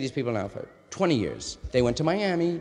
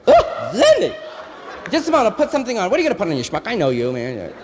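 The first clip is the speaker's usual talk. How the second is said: high, loud camp greeting; effeminate friend voice; 5 semitones higher, 5 dB louder, faster than usual. Retold or imitated. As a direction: gush like a flamboyant friend. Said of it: "So he goes".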